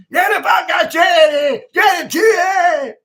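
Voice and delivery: high, roaring Scottish yell; garbled, very loud Scots bellowing; aggressive Scottish accent. Shown as a demonstration. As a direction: shout like a rowdy Scottish football fan. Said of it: "sound really aggressive when they talk".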